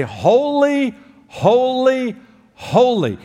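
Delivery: each Holy sung out high and loud, falling at the end; thrice holy proclaimed. Shown as a demonstration. Preacher voicing angels proclaiming holiness, high and loud. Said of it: "pay attention the third time".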